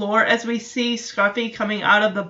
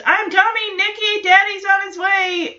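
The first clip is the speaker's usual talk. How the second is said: calling upstairs, higher, half-shouted; imitating a dad yelling up the stairs; mock yell upstairs; 9 semitones higher than usual. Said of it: "Jesse's screaming upstairs".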